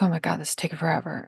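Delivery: quick, quiet grumble under the breath; grumbling. Acted. Imitating a grumbler in a long supermarket line, grumbling. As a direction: mutter a hurried complaint about the wait. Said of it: "they start grumbling".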